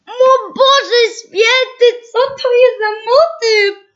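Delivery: high, slow, drawn-out gasp of shock; shocked squeal. Real, not acted. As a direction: squeal it out in disbelief. Shocked by garish game theme.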